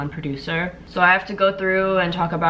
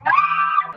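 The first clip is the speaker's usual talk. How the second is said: stressed yelp. Real, not acted. Stress cry.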